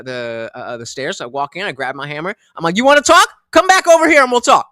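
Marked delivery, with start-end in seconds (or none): 2.8-4.7 high, loud call-out; retold angry challenge. Dog walker with hammer calling stranger back, angry shout. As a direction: dare him to come back, shouting.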